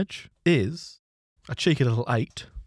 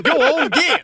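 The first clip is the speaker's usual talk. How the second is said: high, loud shoo; shooing shout; 11 semitones higher, 6 dB louder than usual. Retold or imitated. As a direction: shoo it away with a yell. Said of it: "I was like".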